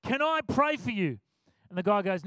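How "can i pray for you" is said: fed-up mock scold; tired, frustrated snap. Tired preacher cuts off rambling passenger, exasperated. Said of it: "tired, frustrated".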